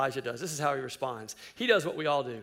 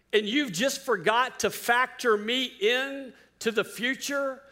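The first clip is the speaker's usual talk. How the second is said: preaching; 5 semitones higher, 4 dB louder than usual. Acted. Preaching at full force.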